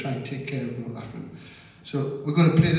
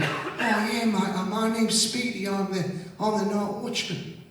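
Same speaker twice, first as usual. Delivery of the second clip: lightly voiced, higher, softer old watchman; a hint of a shy old night watchman; 8 semitones higher, 3 dB quieter, slower than usual. The night watchman answering the singer, a light higher voice.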